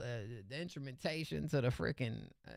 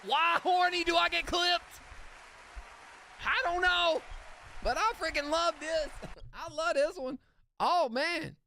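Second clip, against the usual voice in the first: squealing with delight at the track; giddy excitement; 13 semitones higher than usual. Real, not acted. Streamer thrilled his clip made the song, high and giddy.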